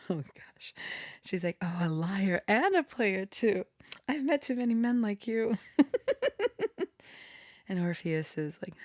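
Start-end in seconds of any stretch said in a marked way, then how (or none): 1.6-5.6 higher, quicker mocking put-down; sassy Eurydice. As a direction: roll your eyes at a smooth-talking man. Voicing Eurydice's sassy comeback.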